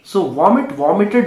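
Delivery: ordinary talk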